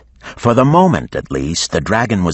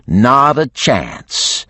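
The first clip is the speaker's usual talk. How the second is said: very slow, higher, deliberate no; defiant refusal; 7 semitones higher, much slower than usual. A narrator's reading. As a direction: spell out the refusal. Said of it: "Geneva replied".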